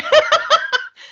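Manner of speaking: high, loud burst of laughter. Real, not acted. Speaker laughing at their own joke that went nowhere, high loud laugh.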